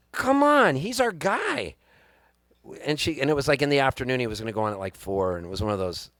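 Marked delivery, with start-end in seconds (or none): 0.1-1.7 pleading. Retold or imitated. Urging wife to go to show.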